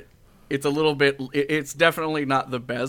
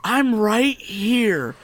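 high, slow, loud exclamation; laughing protest; 10 semitones higher, 9 dB louder, much slower than usual. Real, not acted. Host caught out by the accent complaint, mock outrage. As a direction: protest loudly through the group's laughter.

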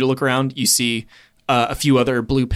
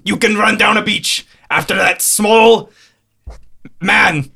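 bellowing over the wind; 6 semitones higher, 5 dB louder than usual. Acted. Gruff Scottish captain voice.

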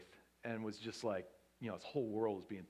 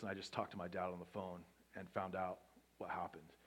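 hushed whisper; 4 semitones lower, 6 dB quieter than usual. Retold or imitated. Nephew's text read aloud.

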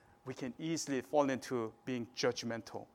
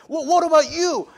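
loud, high-pitched indignant retort; acted-out defensive outburst; defensive counterattack; 12 semitones higher, 14 dB louder than usual. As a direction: throw the accusation straight back. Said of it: "put up my defensive, like, mechanism".

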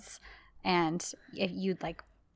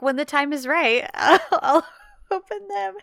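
high, loud, breaking into laughter; laughing quote; 7 semitones higher, 9 dB louder than usual. Retold or imitated. Retelling Serkan's maddeningly calm answer to Eda, laughing. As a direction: giggle through the retold line. Laughs while quoting unbothered man.

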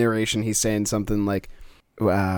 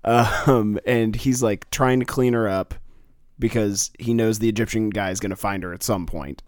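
usual speaking voice

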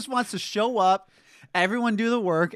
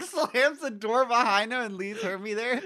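normal talking voice

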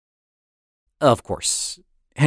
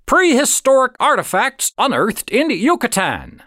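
headline announcer; 11 semitones higher than usual. A narrator's reading. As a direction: bark the headline like a newsboy. Headline read aloud.

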